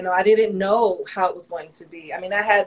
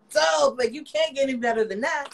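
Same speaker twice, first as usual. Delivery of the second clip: higher-pitched, thrilled retelling; excited reaction; 5 semitones higher than usual. Retold or imitated. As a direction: re-enact being delighted by great news. Retelling her delighted reaction.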